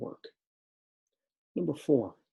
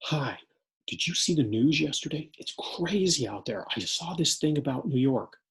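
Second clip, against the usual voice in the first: anxious student; 5 semitones higher, 4 dB louder than usual. Acted. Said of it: "Scenario two".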